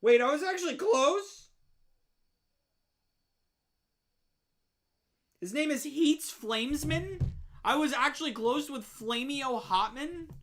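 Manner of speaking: shouting, high and stunned; real shock. Real, not acted. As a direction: blurt out in real disbelief. Shocked his guess was close.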